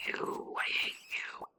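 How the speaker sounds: hissed whisper. Real, not acted. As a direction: whisper it with spite. Whispered at the bats.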